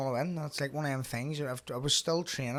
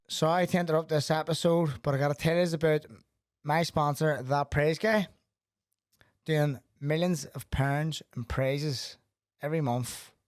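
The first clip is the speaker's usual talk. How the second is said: louder, upbeat sponsor plug; ad read; 4 semitones higher, 8 dB louder than usual. Acted. Host breaking off the episode to plug a sponsor. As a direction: sell it like a podcast ad.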